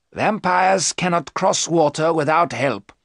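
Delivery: slow, high, emphatic professor; explaining professor. Acted. Professor explains vampire limits to his friends, emphatic.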